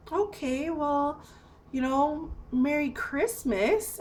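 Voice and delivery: high, halting mimicry of an uneasy farewell; retold awkward goodbye.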